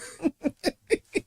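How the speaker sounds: high burst of real laughter; bright high laugh. Real, not acted. Laugh after impression.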